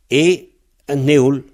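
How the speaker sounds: usual speaking voice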